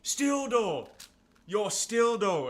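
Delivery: "Still do" pitched high, falling hard; slow, emphatic Toronto "still". Shown as a demonstration. Showing Toronto guys agreeing mid-conversation, Toronto accent. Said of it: "when someone's talking, you're like".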